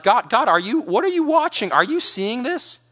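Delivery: high-pitched, baffled questioning of God; incredulous prayer. Acted. Preacher voicing anyone wondering if God sees, incredulous. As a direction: let the questions climb in disbelief. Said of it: "wondering, like".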